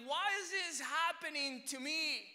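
high, loud, drawn-out protest; re-enacted anguished yell; upset outcry. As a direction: shout the question slowly at heaven. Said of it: "And I was so upset".

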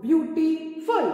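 "beautiful" loud and stretched syllable by syllable; loud syllable demonstration. Shown as a demonstration. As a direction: say "beautiful" loudly, very slowly, in separate beats. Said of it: "speak loudly".

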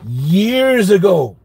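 slow emphasis. Shown as a demonstration. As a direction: linger on years ago, lifting it.

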